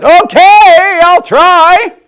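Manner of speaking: put-on high, loud, eager girl; loud child voice. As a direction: still booming when told to hush. Loud girl promises to try.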